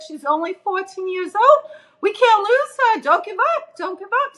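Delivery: high, frantic pleading, imitating the panicked dentist; frantic crying plea. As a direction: mimic a panicked man begging the team not to quit. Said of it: "he was screaming, crying".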